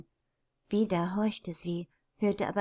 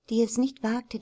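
usual speaking voice

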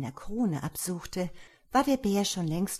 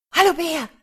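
high, loud, delighted greeting; joyful call; 11 semitones higher, 12 dB louder than usual. A narrator's reading. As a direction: shout a happy hello to your friend. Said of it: "rief er freudig".

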